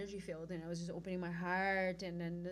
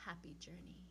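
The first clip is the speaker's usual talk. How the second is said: very soft imitation of a stranger's parting words; hushed farewell; 13 dB quieter than usual. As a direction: say it almost under your breath.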